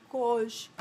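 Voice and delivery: low, soft aside; dread under breath. Retold or imitated. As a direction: say it small, dropping in pitch. Caught by the teacher.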